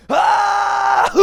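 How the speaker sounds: high, loud shriek sinking into a breath; scream winding down. Retold or imitated. Girl as the coaster stops, scream running out. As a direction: scream high, then let it deflate.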